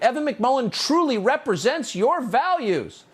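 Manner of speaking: high, slow, sugary mock read of the ad; mock sincere reading. Host mocking a rival ad to viewers, high slow mock sincerity. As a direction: read their slogan back in a high, slow, syrupy parody.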